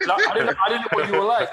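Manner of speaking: high, loud laughing confession. Real, not acted. Laughs confessing his fears.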